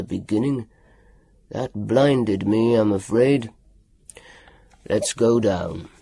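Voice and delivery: low growled doctor's voice; a low menacing growl. A narrator's reading. Doctor keeps speaking.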